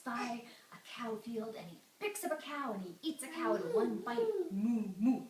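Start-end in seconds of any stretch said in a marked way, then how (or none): 4.5-5.2 loud mooing; imitated cow. Storyteller imitates the cow being eaten, loud mooing. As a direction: moo like a cow in trouble.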